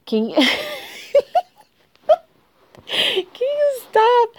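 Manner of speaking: high squealing laugh, slow. Real, not acted. Giggling, pinned down playfully.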